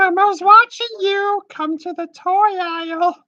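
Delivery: very high, slow puppet voice; Elmo voice. Pretend. Prank-calling the store as a high, sweet puppet. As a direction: coo it like a furry red puppet. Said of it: "Elmo loves you".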